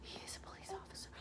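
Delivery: soft, unvoiced disbelieving whisper. Asking the young girls about the guide, stunned whisper. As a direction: barely breathe the question. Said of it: "I just stood at them like".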